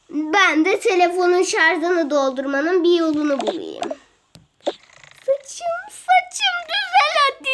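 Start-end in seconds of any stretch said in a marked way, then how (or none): 5.2-7.5 pleading fuss. Acted. Pleading with her hair.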